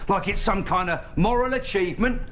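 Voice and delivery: rising fury, ending in a shout; angry rant building. Acted. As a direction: build from scorn to a yell.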